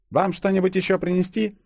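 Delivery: high, polite waitress voice. A narrator's reading. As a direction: offer service in a high voice. Waitress offers service. (официантка, высоким голосом)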